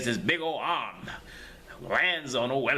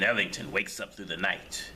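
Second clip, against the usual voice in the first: ordinary delivery, nothing marked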